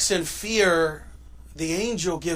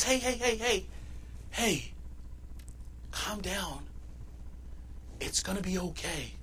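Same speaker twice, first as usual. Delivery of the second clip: soft, hushed calming voice, much softer; soothing angel; 13 dB quieter than usual. Acted. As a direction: gently settle frightened women, quietly. Angel calming frightened women.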